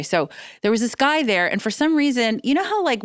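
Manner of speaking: ordinary talk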